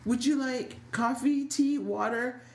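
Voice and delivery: bright mock offer; eager host. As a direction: overeager hostess listing drinks. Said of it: "Brooklyn and Chase".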